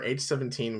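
ordinary talk, nothing marked

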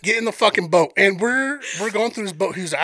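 ordinary delivery

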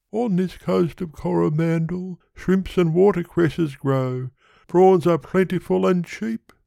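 high-pitched pleading suitor; Yonghy Bonghy Bò. Acted. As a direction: propose in a high character voice.

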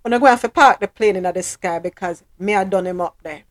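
Jamaican patois with fast, fired-up bursts. Acted. Ranting about a fight on a plane in Jamaican patois. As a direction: rant it in thick Jamaican patois. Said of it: "Me I call, typical Jamaican".

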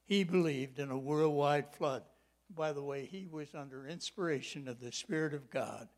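ordinary talk, nothing marked

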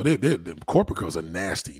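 ordinary talk

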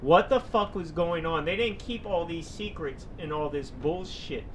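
normal talking voice

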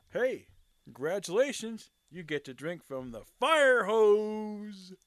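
loud, drawn-out mock announcement; movie quote delivery. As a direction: belt out the movie line, stretching the last words. Performs a film quote.

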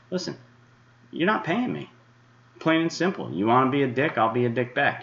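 pitched well up, heated rant; angry. Real, not acted. Forecaster angrily tells freeloading followers off. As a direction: bite it off at the trolls.

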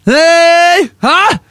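high, loud mock cheer; excited yell. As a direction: whoop it up at the joke. Cheering the bad pun.

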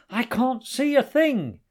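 high, loud cry; loud, high-pitched acted shout; panicked shout. A narrator's reading. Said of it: "he shouted".

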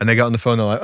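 ordinary talk, nothing marked

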